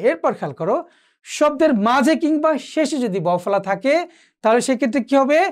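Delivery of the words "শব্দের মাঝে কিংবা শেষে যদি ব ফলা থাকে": usual speaking voice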